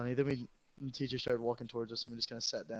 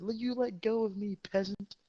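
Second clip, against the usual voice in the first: high, louder snooty aristocrat tone; mock haughty; 9 semitones higher, 3 dB louder than usual. Student mocking hated teacher as peasant, snooty and high. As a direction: dismiss her like royalty scolding a servant. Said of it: "I said".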